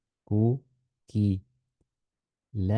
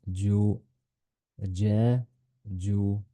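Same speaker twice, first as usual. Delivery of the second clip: slow, drawn-out syllables for a learner; slow syllable drill; much slower than usual. Shown as a demonstration. Teacher slowly models جا and جو for pupils. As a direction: spell out جا جو slowly, each vowel long. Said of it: "teacher drills syllables".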